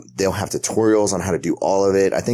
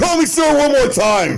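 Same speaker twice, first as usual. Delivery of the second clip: much higher, louder, slowed threat; imitating the customer; 6 dB louder than usual. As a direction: camp falsetto warning, drawn out.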